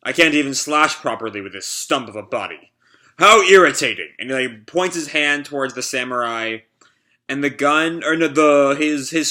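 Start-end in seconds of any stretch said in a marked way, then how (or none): 3.2-4.1 annoyed. Acted. Villain annoyed.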